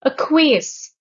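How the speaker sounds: "Acquiesce" with the last syllable stressed; stress on third syllable. Shown as a demonstration. Teacher showing learners a new word, last syllable of "Acquiesce" stressed. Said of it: "third syllable".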